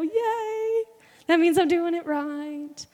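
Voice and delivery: a high, loud "Yay!" then a slow, loud line; mock-gleeful celebration; excited cheer. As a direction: cheer like you just won. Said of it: "Get excited".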